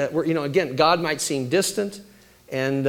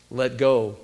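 voice from above; 4 semitones lower than usual. Retold or imitated. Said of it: "and a voice says".

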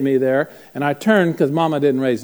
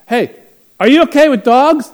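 loud, high call from another room; shouted question; 11 semitones higher, 11 dB louder than usual. Retold or imitated. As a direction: holler a question from behind a wall. Man calls from behind wall.

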